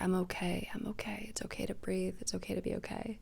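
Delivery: whispered, soothing the fear away; soft reassuring whisper. Pretend. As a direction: breathe it out as a gentle whisper. Baby's inner voice soothing her.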